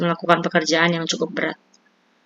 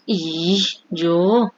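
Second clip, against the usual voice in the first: whining. A narrator's reading. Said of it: "rengek Lili".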